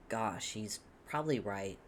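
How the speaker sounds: ordinary delivery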